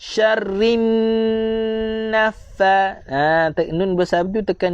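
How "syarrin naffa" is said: "Syarrin naffa" with held, doubled rr and ff; sit on the shadda in "Syarrin naffa"; doubled consonants. Shown as a demonstration. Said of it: "tekankan dia dua harakat". (tekan sabdu pada "Syarrin naffa")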